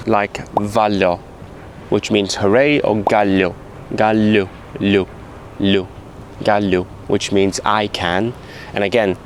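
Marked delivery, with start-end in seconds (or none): 0.5-1.2 vallo slow, with a soft L; Lithuanian soft L. Shown as a demonstration. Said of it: "one of the hardest sounds to make is a soft L". 3.0-6.8 gallo and lu with a soft L; Lithuanian soft L. Shown as a demonstration. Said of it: "hardest sounds to make is a soft L".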